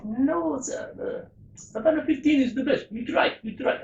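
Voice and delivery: put-on an amateur's French accent for the barman. Barman apologising to a skier, mock French accent. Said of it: "excuse my accents".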